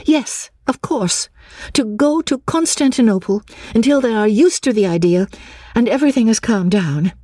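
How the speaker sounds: high, eager agreement; keen, high-pitched acceptance. A narrator's reading. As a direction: seize on the idea in a high voice. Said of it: "accepted the hint eagerly".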